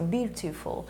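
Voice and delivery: beautiful said the British way, with a clear t sound, and softly; beautiful with a crisp British t, quiet; British English beautiful, said softly; British pronunciation. Shown as a demonstration. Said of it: "Como eles pronunciam".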